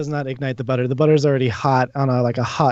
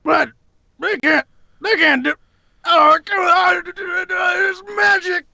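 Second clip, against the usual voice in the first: high, strained shouting in disbelief; high-pitched indignant holler, last words drawn out; outraged character; slower than usual. Acted. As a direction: wail the protest at a very high pitch. Game master voices outraged monster.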